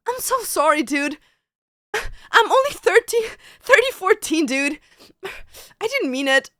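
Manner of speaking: put-on high, whiny anime boy with sighs; mocking impression. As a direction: ham up a flustered e-boy. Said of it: "Which person speaks like that?".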